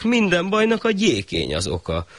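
high shah voice; the shah blaming the mat; a lightly high royal pronouncement; shah decreeing. Acted.

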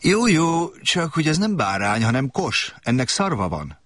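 little prince, gently amused; indulgent little prince. A narrator's reading. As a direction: smile through it like a patient child. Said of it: "kedvesen, de elnézően mosolygott".

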